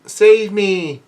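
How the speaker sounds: restrained high-pitched plea; mock cry for help. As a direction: call for rescue without full force. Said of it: "somebody yells out".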